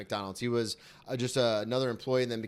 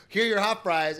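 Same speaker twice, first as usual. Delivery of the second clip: sassy comeback; 8 semitones higher, 6 dB louder than usual. Retold or imitated. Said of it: "and be like".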